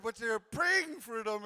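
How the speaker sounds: usual speaking voice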